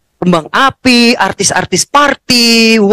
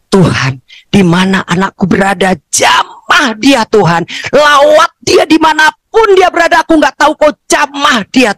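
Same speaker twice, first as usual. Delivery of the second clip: fervent prayer; 7 semitones higher than usual. Retold or imitated. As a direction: cry out to God to touch him. Retold urgent prayer for son.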